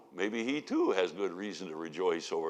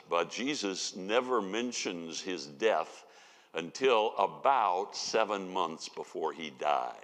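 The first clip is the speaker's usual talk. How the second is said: normal talking voice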